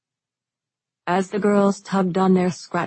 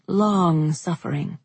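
plain reading voice

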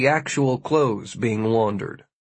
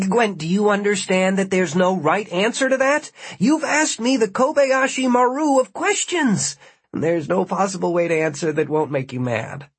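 exasperated pleading; 7 semitones higher than usual. Acted. Martin trapped, defending himself.

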